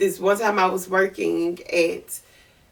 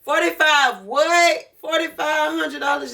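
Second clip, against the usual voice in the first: stunned disbelief; 8 semitones higher than usual. Retold or imitated. Retelling the offer in disbelief.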